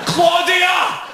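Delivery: high-pitched, furious shout with words dragged out; angry drunken yelling. Acted. Drunk man yells at a woman after losing the vote, angry shouting. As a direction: yell it across the street, voice pushed right up.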